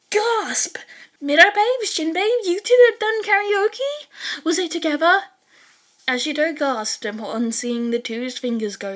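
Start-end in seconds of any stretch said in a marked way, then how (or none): 0.1-5.2 high, loud squealing excitement; thrilled, demanding the gossip; excited gossip. Acted. Ashido excited about karaoke gossip.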